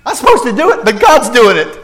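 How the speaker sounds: high, loud puzzled protest; mock bewilderment. Pastor acting a confused believer facing the paradox, high and loud.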